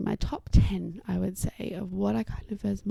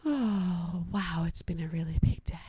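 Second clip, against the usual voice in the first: calming co-regulation; 4 semitones lower than usual. Shown as a demonstration. Parent sitting next to overwhelmed child, soothing on sighed breaths. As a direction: let each phrase ride out on a long exhale. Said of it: "model some really big breaths".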